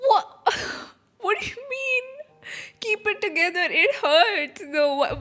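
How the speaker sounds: ordinary talk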